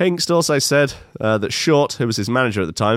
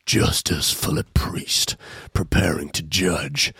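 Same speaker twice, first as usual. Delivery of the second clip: low, slow breathy trailer voice; mock ominous announcer; 7 semitones lower, 4 dB quieter, slower than usual. Shown as a demonstration. Host intoning the judge's name like a trailer, low and slow. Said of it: "repeated name in put-on voice".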